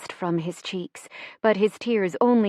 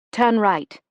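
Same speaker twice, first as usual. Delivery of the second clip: raised, louder command; urgent direction; 3 semitones higher, 5 dB louder than usual. A narrator's reading. Directing the driver during an air raid, urgent. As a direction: call out the turn urgently.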